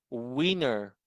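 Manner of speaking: "Winner" with a stretched oo onset. Shown as a demonstration. Teacher gives an example of W, "Winner" begun on a long oo. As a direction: begin "Winner" as oo-ee-ner. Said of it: "Ano yung bigkas sa W".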